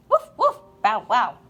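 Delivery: two playful dogs barking; puppy barks. Acted. Two dogs bark in book.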